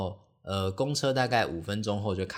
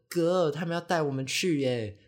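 higher, excited call to her brother; higher, excited call across the street; 8 semitones higher, 3 dB louder than usual. Retold or imitated.

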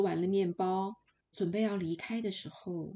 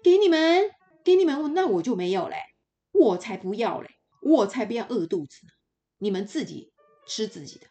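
rude refusal; 5 semitones higher, 10 dB louder, faster than usual. Acted. Rude girl refuses sharing cake.

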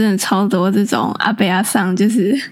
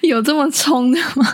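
ordinary delivery

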